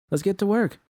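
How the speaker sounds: upbeat and high, ready to go; a bright call to start working; energized. A narrator's reading. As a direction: clap and rally the room. Narrator voices herself, energized.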